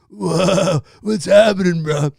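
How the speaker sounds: low laid-back stoner; easy stoned-dude drawl. Shown as a demonstration. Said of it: "It's a stoner voice, man".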